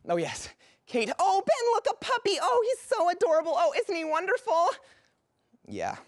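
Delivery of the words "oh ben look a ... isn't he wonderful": very high, squealing puppy adoration; gushing. Acted. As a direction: coo loudly in delight over a puppy.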